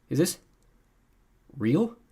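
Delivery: halting, disbelieving question; in shock. A narrator's reading. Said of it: "still in shock".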